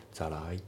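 "ザライ" with the T turned to an L sound; fuse both words in "ザライ"; linked pronunciation. Shown as a demonstration. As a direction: demonstrate "ザライ" with a tapped T. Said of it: "Lで濁りますね".

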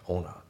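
"オーナー" as on and our joined, o-na; linked words. Shown as a demonstration. Teacher showing learners linking in on our, joined. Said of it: "オンアワーのところもリンキングで".

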